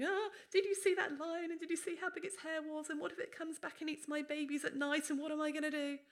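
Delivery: high, loud, breathless fretting; panicky worrier. Retold or imitated. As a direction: gush out the worries in a rising panic. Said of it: "I'd be phoning my friends and going".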